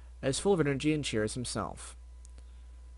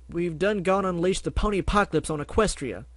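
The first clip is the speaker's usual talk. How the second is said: crying out in terror; 6 semitones higher, 5 dB louder than usual. Acted. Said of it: "the farmer cried out".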